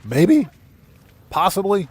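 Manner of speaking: ordinary talk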